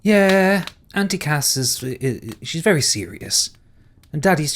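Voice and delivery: put-on high, bright little girl; child voice. Caitlin the child answering the stranger in a high little voice. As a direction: pipe up like a small child.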